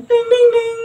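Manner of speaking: high sing-song ding; high chime imitation.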